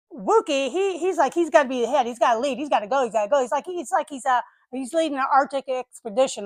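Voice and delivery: ordinary talk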